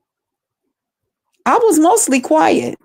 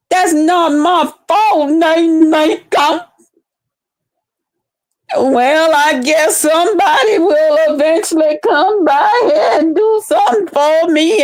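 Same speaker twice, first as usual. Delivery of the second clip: put-on drawling caricature; slow, hammed-up old-time voice; mock slow talk; 2 semitones higher than usual. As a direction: drag the words in mocking imitation. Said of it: "talking real slow".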